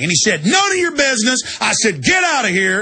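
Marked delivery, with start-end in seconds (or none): 0.4-1.4 high snide trooper voice; mock trooper. Trooper's reply, acted in story. 2.0-2.8 raised, barked get out; mock order. Retold order to leave.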